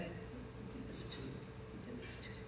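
slow, soft whisper; whispered pleading. Retold or imitated. Kind man repeating his question to the beaten girl, whispering. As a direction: whisper it slowly, aching.

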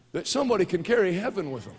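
ordinary talk

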